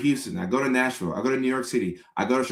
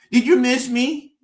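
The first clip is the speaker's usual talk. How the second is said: much higher, louder sing-song ask; needy partner; 11 semitones higher, 5 dB louder than usual. Acted. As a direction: fish for a yes, high and eager. Partner asks after Florida trip.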